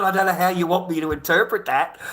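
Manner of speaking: loud, high, baffled self-defence; acted-out exasperated protest; defensive protest. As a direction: plead confusion while retelling it. Retells defending himself to boss.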